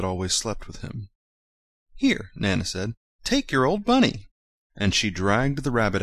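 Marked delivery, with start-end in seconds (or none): 1.9-2.3 put-on high, kindly old nurse voice; Nana's voice. A narrator's reading. Nana offering the boy his old bunny, high voice. 3.2-4.3 put-on high, kindly old nurse voice; Nana's voice. A narrator's reading. Nana offering the boy his old bunny, high voice.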